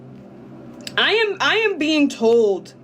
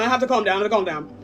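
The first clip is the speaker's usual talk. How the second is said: usual speaking voice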